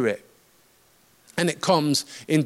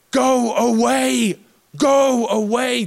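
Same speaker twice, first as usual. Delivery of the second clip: high, slow shout, both times stretched; drawn-out holler; 5 semitones higher, slower than usual. Acted. Preacher re-enacts the man rejecting Jesus, drawn-out holler. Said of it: "to holler".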